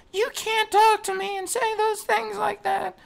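high put-on whiny voice; mocking Bobby voice. Imitating Bobby going off at a bully, high whiny voice. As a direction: mimic the bullied guy going off. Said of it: "you guys do the voice way better".

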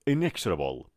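"Inexorable" as "in-EKS-ra-bol", short middle; repeat-after-me model. Shown as a demonstration. Teacher leading a repeat drill, "Inexorable" with ks. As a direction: give "Inexorable" with a crisp ks for the class. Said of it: "پشت سر من بگید".

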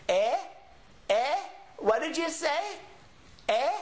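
high, questioning hard-of-hearing grandpa. Acted. Teacher imitating Grandpa who didn't catch it, high querying old-man act. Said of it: "think about Grandpa that goes".

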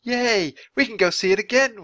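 usual speaking voice

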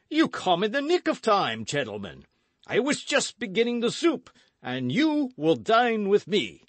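high, hearty, booming host; boisterous Porthos. A narrator's reading. Porthos welcoming friends to dinner, boisterous. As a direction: play an expansive, boastful big musketeer.

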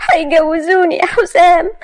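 put-on high, slow, tearful young woman; tearful daughter to her sweetheart; distraught. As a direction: break the news through tears. Maria tells Hossam, distraught.

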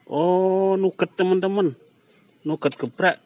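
jumps high and loud in surprise; sudden delighted realization; surprised discovery. Real, not acted. Discovering the filling is nugget.

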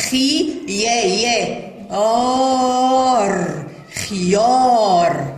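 slow خي, repeated يا, then a long held آر and drawn-out خيار; yaa pronounced. Shown as a demonstration. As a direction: spell out خيار, then blend it slowly. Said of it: "تنطق ولا تمد". (تهجئة خيار ببطء مع إطالة آر)

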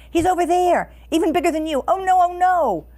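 high, loud feigned panic; hammed-up storybook alarm, pitched well up; crying out. A narrator's reading. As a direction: wail a false warning to fool the monster. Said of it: "cried Sense Pass King".

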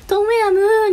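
high, loud, drawn-out call; name-only call-out. Shown as a demonstration. Streamer demos her planned name call, drawn-out shout. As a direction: holler the bare name like a chant. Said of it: "二番は呼び捨てしなきゃいけないんで".

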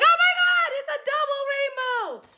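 high, loud squeal of joy; thrilled cry at a double rainbow; ecstatic shout. Retold or imitated. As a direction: lose it over the double rainbow. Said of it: "And then I'm like".

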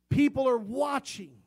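"people" hit high and loud; loud, high warning. Real, not acted. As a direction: call it out to the congregation. Preacher repeats warning phrase.

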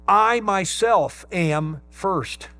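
I myself am first, slow, with each word leaned on hard; emphatic I myself. Shown as a demonstration. Preacher stressing Paul's own words, slow and emphatic. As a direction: dwell on "I myself" as a confession. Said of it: "It's a double emphatic".

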